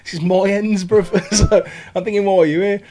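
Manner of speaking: high, loud hammed-up street lad with laughs; mock roadman. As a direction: play the territorial local lad, laughing. Mock territorial local lad voice.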